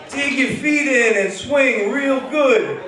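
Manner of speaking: high, slow, drawn-out holler; yelled coaching. Retold or imitated. Beach guy coaching whoever he plays with, calling out. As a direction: call the instruction out across the beach. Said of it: "he yells out".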